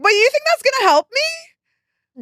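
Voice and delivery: high, slow, incredulous challenge; indignant retort. Retold or imitated. Niece confronting the aunt who called her fat, high and incredulous. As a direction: stretch it out, high and disbelieving.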